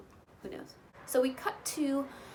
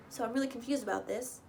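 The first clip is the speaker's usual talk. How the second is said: ordinary delivery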